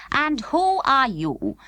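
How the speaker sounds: slow, high, drawn-out questioning doll; a slow, suspicious question; cold voice. A narrator's reading. Marchpane questions Totty from the opposite table, slow.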